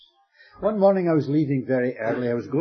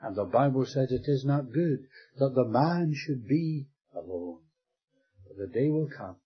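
ordinary delivery

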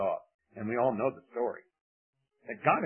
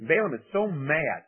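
normal talking voice

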